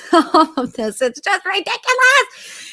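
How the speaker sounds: squeaky, drawn-out high voice; high exasperated squeal. Pretend. Despairing at all that is going down, high squeal. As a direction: squeeze it out very high and stretched.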